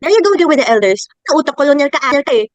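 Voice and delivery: loud, exasperated jab at a relative; angry accusation. Acted. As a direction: throw it out loudly in an argument. Acted family quarrel over elders.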